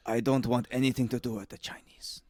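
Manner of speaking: whispered grumble. Retold or imitated. A boss whispering his prejudice to his worker. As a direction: hiss it under your breath.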